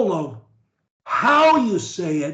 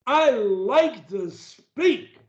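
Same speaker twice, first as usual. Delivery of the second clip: high and slow, "like" and "speak" drawn out; meaning it; 5 semitones higher, slower than usual. Shown as a demonstration. Coach modelling for a stutterer to repeat, slow and emphatic. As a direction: stretch each word, lifting "like" and "speak". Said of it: "say it like I mean it".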